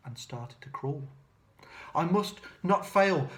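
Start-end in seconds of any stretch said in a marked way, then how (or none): 2.0-3.3 higher, louder, resolute little voice; determined. Acted. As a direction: steel yourself to save him. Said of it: "said the tiny snail".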